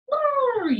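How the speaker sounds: high loud lip-trill bray; hammed-up zebra noise. Shown as a demonstration. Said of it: "think about what sounds those animals make".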